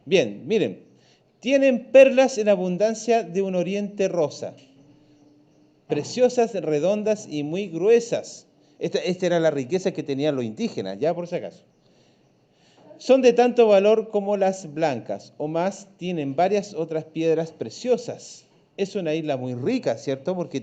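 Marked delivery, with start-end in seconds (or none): 1.4-8.5 raised, louder read-aloud voice for the class. Acted. Teacher reading the textbook aloud to the class, raised and loud. 13.0-18.5 raised, louder read-aloud voice for the class. Acted. Teacher reading the textbook aloud to the class, raised and loud.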